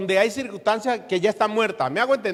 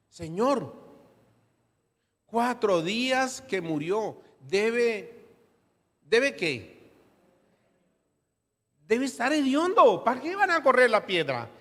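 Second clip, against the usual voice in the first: Marta complaining that the dead man must stink; Marta protesting; 5 semitones higher than usual. Acted. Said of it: "protestó". (Marta protestando con voz aguda)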